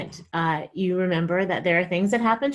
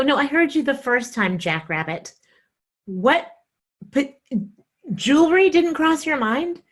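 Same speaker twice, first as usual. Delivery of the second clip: higher and louder, exasperated; 6 semitones higher, 5 dB louder than usual. Retold or imitated. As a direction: snap back in disbelief. Angry retort to husband.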